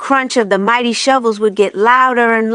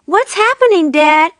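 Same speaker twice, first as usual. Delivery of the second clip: high, worried little fox cubs; young cubs calling up to their father; small foxes crying out; 9 semitones higher than usual. A narrator's reading. Small foxes crying to their dad. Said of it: "cried the small foxes".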